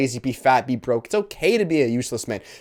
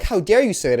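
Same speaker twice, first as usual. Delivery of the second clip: retold indignation, raised in pitch; mock offended; 6 semitones higher than usual. Retelling angry reactions from critics, mock indignant and higher. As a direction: imitate someone mad at you, pitched up. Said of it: "will get mad at me".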